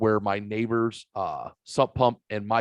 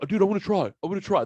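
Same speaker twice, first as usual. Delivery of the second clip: put-on higher, eager kid voice promising; mock the kid's earnest promise, pitched up; imitating the shy kid; 8 semitones higher, much faster than usual. The shy kid leaving, promising to try, imitated higher. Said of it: "That's his".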